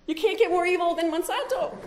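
high, loud, laughing jab. Real, not acted. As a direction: say it while cracking up at the joke. Laughing at her own jab.

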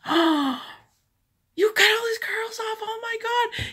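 high, loud horrified gasping; shocked dismay. Retold or imitated. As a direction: react in horror to the haircut.

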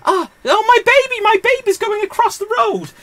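very high, squealing mock panic; shrieky pretend damsel in distress; hammed-up panicking mother. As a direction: cry out in falsetto for help. Acts panicking mother in film retelling.

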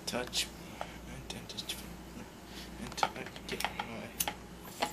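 unvoiced, soft, slow teasing whisper; mock seductive whisper. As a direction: breathe it like a fake seduction.

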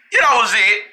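ordinary talk

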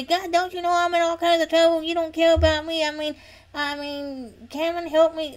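high-pitched, indignant complaint to God; mock angry, entitled prayer; accusing prayer. Shown as a demonstration. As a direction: whine it out, high and demanding. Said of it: "No, that's not how you pray".

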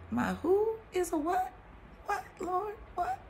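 high, incredulous what; mock crying cry of disbelief; retold disbelief. Retelling asking the Lord about her dad, high shocked disbelief. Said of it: "I'm crying like".

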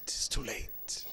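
soft unvoiced whisper of "too late"; whispered warning. Real, not acted. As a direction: breathe "It's too late" as a grave warning. Hushed warning of Judgement Day.

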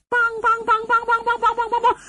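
rapid high monotone banging noise; quick-fire vocal percussion; imitated clapper alarm. A narrator's reading. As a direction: rattle off an urgent wooden gong. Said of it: "突然间就听见鸳鸯宫方向一阵".

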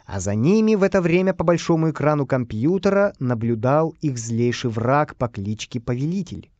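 plain reading voice

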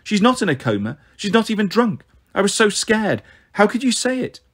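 upset, high-pitched accusations; high, reproachful outburst; rapid-fire reproach. A narrator's reading. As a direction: fire the words out like bullets. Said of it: "Star's words shot out like bullets".

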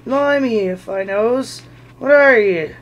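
a troll squinting at something odd; puzzled troll. Acted. William to Bilbo, loud and baffled.

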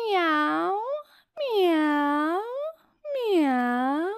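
high rising meow; cat meowing. Acted. Reading the poem to kids, a high kitten meow. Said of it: "Kotek miauczy".

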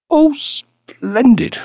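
high, slow, delighted squeal; Ruby cries out in delight, high and drawn out; delighted cry. A narrator's reading. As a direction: gush it out, thrilled. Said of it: "cried Ruby".

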